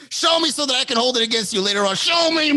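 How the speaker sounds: loud, high, frantic demand; hammed-up egging-on, ending on a yell; mock goading yell. Voices her goading him to snap.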